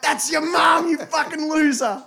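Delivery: high loud gloating yell; triumphant shout. Retold or imitated. Gloating at the teen gamer about his mum, loud triumphant shout. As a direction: crow over the kid, loud.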